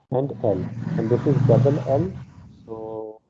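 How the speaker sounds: ordinary talk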